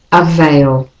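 ordinary talk, nothing marked

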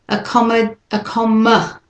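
"Accommod-" with weak uh in the middle syllable; schwa demo. Shown as a demonstration. Teacher isolates the schwa for learners to hear. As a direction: clip "accom-" to show the schwa. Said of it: "can you hear that?".

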